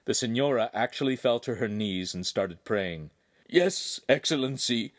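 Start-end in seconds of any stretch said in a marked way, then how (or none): 3.5-4.9 whimper. A narrator's reading. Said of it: "Agostino whimpered".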